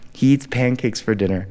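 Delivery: ordinary delivery, nothing marked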